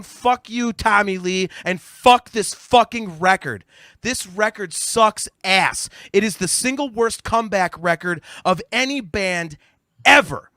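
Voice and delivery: loud, high, emphatic condemnation; real exasperated tirade, hammering the insults; furious rant. Real, not acted. Furious rant trashing the record.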